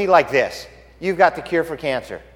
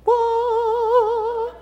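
mock angelic singing. Angels singing praises over the shepherds, high sung note. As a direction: sing one long high angelic "ooh". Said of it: "all the angels are singing praises".

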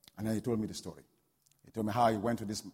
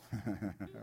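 soft breathy chuckle; low-key run of chuckles; 5 semitones lower, 12 dB quieter than usual. Real, not acted. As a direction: chuckle quietly to yourself. Chuckles after making his point.

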